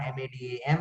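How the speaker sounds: "madam, M A D A M" whispered letter by letter; spelling madam in a whisper. Shown as a demonstration. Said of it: "What is a palindrome".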